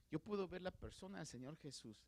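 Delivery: ordinary talk, nothing marked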